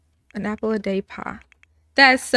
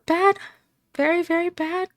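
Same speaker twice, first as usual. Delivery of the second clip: higher, whiny mock-sad; 8 semitones higher than usual. Grieving girl telling grandma, mock sad. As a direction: pout it out like a sulky kid.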